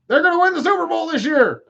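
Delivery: high, loud, fast mock cheer; mock fan hype. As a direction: squeal it like a deluded superfan. Mocking hopeful Cowboys fans.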